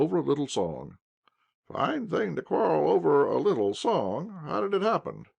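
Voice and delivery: put-on high, fussy old officer; a lightly voiced old soldier. A narrator's reading. Old officer chatting to young Pyotr, fussing. Ivan Ignatiitch voiced in dialogue.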